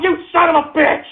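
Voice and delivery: furious yelling. Real, not acted. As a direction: lash out at the chat. Ranting at trolls on show.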